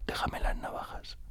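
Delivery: slow, voiceless whisper; greedy whisper. Acted. The sister asks the bride for razors, slow intent whisper. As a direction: whisper it slowly, wanting them badly.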